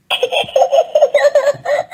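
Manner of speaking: high squealing giggle; high snorting laugh; piggy giggle. Acted. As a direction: giggle like a little pig. Peppa laughs.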